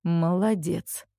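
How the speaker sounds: slow, let-down sigh of a word; disappointed exhale. A narrator's reading. Father to son, not hiding his letdown, disappointed. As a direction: breathe it out with open disappointment. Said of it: "выдохнул отец, даже не пытаясь скрыть разочарование".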